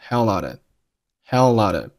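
"hell out" joined through the l; linked "hell out". Shown as a demonstration. Teacher showing how "hell out of" links. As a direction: run "hell" straight into a reduced "out of". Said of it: "Hell和out连读成了hell out".

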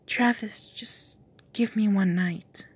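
soft breathy coaxing, fading to a whisper; seductive whisper. Acted. Lucinda whispering to Travis to tempt him. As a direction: lure him in close and quiet.

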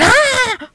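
very high, sustained scream; mock scream. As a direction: let out a comic high shriek. Acts out half a face screaming.

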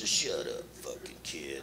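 low hushed hiss. Acted. Man paying off kid, low hushed curse. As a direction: hush him under your breath.

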